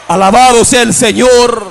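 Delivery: full-voiced preacher's cry of praise; praise exclamation. Real, not acted. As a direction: proclaim it out to the whole congregation. Praise cried out to congregation.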